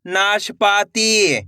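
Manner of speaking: नाशपाती very slow, syllables stretched; blended syllable reading. Shown as a demonstration. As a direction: draw out नाशपाती for learners. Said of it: "तो मिलके बन जाएगा". (नाशपाती को धीरे से खींचकर)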